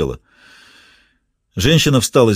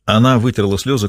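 ordinary delivery

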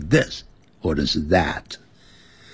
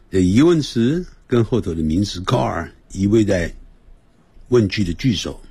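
ordinary talk, nothing marked

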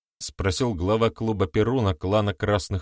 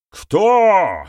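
high, loud roar of a question; furious bark; 11 semitones higher, 9 dB louder than usual. A narrator's reading. Interrogator demands who put him up to it, furious bark. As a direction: bark it, barely holding back rage. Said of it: "С трудом сдерживая бешенство, вновь рявкнул".

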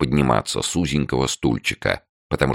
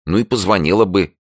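lively urging; 8 semitones higher, 4 dB louder than usual. A narrator's reading. Tanya urging her friend.

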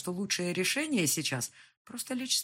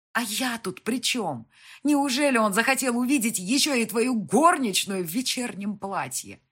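loud, raised, incredulous questioning; indignant sarcasm; 6 semitones higher, 9 dB louder than usual. A narrator's reading. Heroine asking why the guest needs her, indignant sarcasm. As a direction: question him with biting sarcasm.

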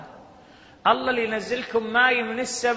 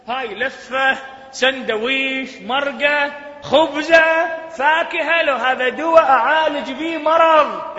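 from raised to loud, stretching each word; building outrage; 6 semitones higher, 7 dB louder, slower than usual. Real, not acted. Preacher listing foods up to medicine, rising to a loud outcry. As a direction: climb louder with every item.

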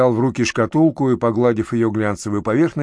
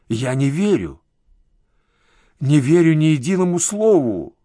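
distressed denial, rising in pitch; anguished disbelief; 4 semitones higher than usual. A narrator's reading. Uncle refusing terrible news on the phone, anguished disbelief. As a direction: refuse the news, building to a higher protest.

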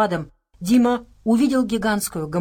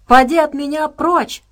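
put-on high, loud angry girl's shout; 8 semitones higher, 7 dB louder than usual. A narrator's reading. Sasha ordering Helium away from her, shouting. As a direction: yell to drive someone away. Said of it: "прокричала Саша".

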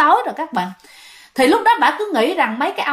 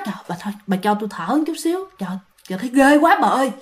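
hurried, hushed panting plea; scared, out of breath; much faster than usual. Acted. Frightened vendor begging a neighbour to let her catch breath, breathless. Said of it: "bà bán bánh phòng bà sợ quá".

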